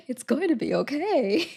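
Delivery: mock soothing, breaking into laughter; calm a friend down while cracking up; laughing mock comfort; laughing reassurance. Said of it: "I'm like".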